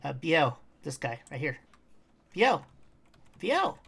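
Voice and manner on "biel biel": louder, higher call of the name; calling out to the pet. Real, not acted. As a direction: call the pet back sharply.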